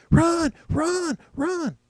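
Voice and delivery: very high-pitched mock urging; mock emphatic. Commentator imitating a witness urging someone to flee, mock emphatic. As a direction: urge it emphatically in a put-on high voice. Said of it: "she was emphatic about it, you know, really serious".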